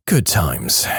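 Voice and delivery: low, falling "Good times"; wry afterthought. Real, not acted. Closing the near-death story, a wry low echo. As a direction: drop the pitch as a dry echo. Repeat of the sarcastic aside.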